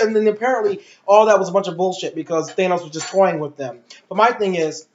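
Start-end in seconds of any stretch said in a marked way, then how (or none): none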